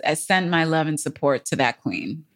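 usual speaking voice

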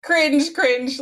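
high, squealed cringe, cringe; playful mock squirm; excited echo. Real, not acted. Echoing co-host's cringe remark.

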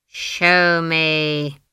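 loud, drawn-out icy order; icy command. A narrator's reading. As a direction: command it coldly, stretching each word. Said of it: "her voice had ice in it".

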